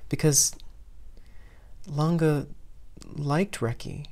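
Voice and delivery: usual speaking voice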